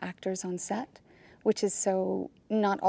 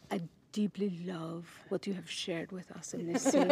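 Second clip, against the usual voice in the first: put-on lightly done French Canadian accent, soft and laughing; a hint of French Canadian in a gentle director's voice; bad French Canadian accent; 6 dB quieter than usual. Actress imitating her director with a mild French Canadian accent. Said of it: "this is my bad French Canadian accent".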